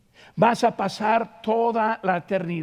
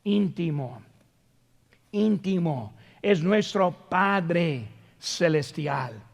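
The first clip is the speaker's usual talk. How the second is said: intimate; 4 semitones lower, 5 dB quieter than usual. Shown as a demonstration. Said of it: "Forma personal".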